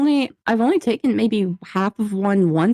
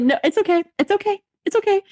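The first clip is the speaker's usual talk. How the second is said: high-pitched, hurried-to-placate quote; retold reassurance; 11 semitones higher, faster than usual. Student telling coworker not to bother, high retold reassurance. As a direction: placate them in a higher voice.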